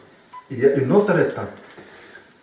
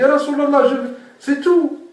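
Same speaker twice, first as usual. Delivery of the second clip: higher, exasperated imitation of the man; playful retold protest, pitched up; mock exasperated plea; 10 semitones higher than usual. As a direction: whine it like he can't believe the same answer. Man protesting the repeated advice.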